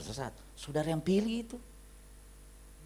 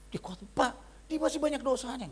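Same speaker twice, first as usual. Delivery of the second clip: protesting wife; 9 semitones higher than usual. Retold or imitated.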